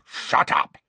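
growled. A narrator's reading. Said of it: "Moody growled".

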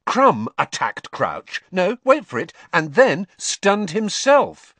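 high, emphatic excited theory. A narrator's reading. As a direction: build excitedly, drawing out the key words. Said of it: "said Ron excitedly".

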